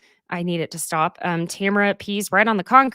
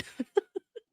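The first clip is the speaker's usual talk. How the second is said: high burst of laughter; 12 semitones higher, 10 dB quieter than usual. Real, not acted. Laughing after the imitation.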